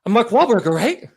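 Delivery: high, out-of-breath chummy greeting; Mark Wahlberg. Acted. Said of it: "He always sounds like he's out of breath".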